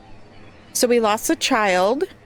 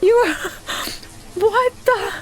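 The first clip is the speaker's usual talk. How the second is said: incredulous laughter; 10 semitones higher, 4 dB quieter than usual. Real, not acted. More losses from the tornado.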